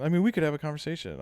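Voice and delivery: ordinary delivery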